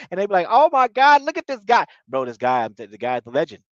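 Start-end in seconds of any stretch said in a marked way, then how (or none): 0.5-1.8 high, loud cry of joy; joyful scream. Retold or imitated. Castaways spotting the hanging pig, shouting for joy. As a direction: shout it out, thrilled to see the pig. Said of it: "screaming for joy".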